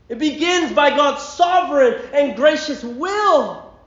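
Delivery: high, loud, slowing proclamation; fervent preaching. Acted. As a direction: drive each line home with fire. Preaching peak on God's election.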